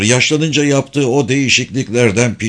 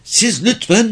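high, put-on mimicking voice; Mark impression; 12 semitones higher than usual. A narrator's reading. Girl imitates the young man's words, high mimicry. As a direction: mimic someone, pitched way up. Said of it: "Mark'ın sesini taklit etti".

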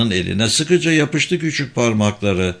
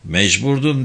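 plain reading voice

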